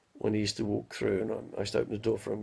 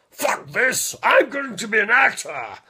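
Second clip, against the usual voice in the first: loud, much higher boom, slowing at the end; booming actor; 10 semitones higher, 8 dB louder, slower than usual. Retold or imitated. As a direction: declare it with theatrical gusto. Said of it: "and he goes".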